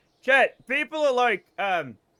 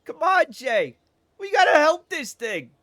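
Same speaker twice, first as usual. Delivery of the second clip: high, loud pleading in mimicry; hammed-up distress, pleading with a buddy; panicked guy impression; 7 semitones higher, 4 dB louder than usual. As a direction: imitate a frantic, high-voiced sidekick. Acting out panicked guy to Jay.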